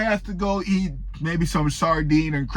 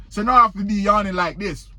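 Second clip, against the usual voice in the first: ordinary delivery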